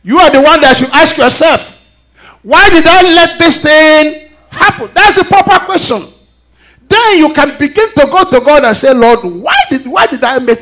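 high, loud, impassioned preaching; preaching at full force. Real, not acted. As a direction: drive the point home to the congregation. Preacher building to peak.

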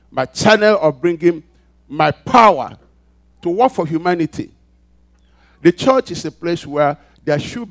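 loud, high, driving pulpit voice; preaching at full force. Acted. Pastor rousing the congregation, preaching at full force. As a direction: build it up and hammer each phrase.

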